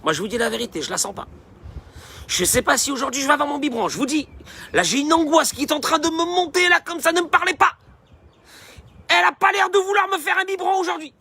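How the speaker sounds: put-on high, tense little-baby monologue, building; anxious baby. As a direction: play a baby fretting aloud, pitch climbing. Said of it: "qui te dit".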